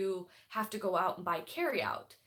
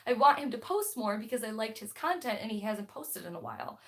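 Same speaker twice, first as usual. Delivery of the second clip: ordinary talk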